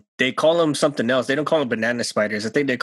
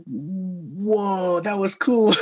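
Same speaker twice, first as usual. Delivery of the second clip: amazed; 7 semitones higher, much slower than usual. Retold or imitated. Retold reaction to owl catch.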